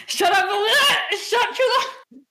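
loud, high shout through laughter; laughing yell. Real, not acted. Player shutting up Alina mid-scene, yelling through laughter. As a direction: yell them down while cracking up.

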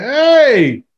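mock calling out. Calling from the porch.

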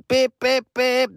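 high mock honk; imitated car horn. Friends honk outside, high imitated horn beeps. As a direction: beep it like a horn. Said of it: "they're honking".

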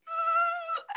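high breathy sob-laugh; sobbing laugh. Retold or imitated. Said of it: "I just am like".

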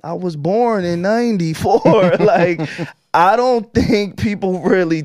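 high, loud, animated outburst; emphatic exclamation. Real, not acted. Guest stressing to host how young they are, emphatic exclamation. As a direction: exclaim it loudly, pitched well up. Stressing own youth against [name]'s career.

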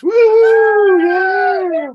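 loud high whoop; cheering. Real, not acted. Contestants cheering on intro.

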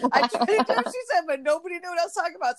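high, laughing through the words; giggling as she retells it. Real, not acted. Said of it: "laugh heard".

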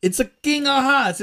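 loud, high, hammed-up mobster accent; Italian-American accent. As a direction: announce it in a mock mobster accent. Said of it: "That's why we're doing accents".

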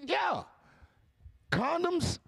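high-pitched mock quiz, question then verdict; mock question and answer. Host spoofing the model's body count rule, mock quizzing.

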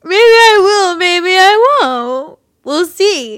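put-on high, loud drunken sobbing; drunk crying. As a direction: wail it like a weepy drunk. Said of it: "I'll just do my drunk voice".

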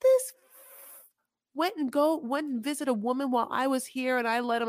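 put-on furious complaining girlfriend; angry.

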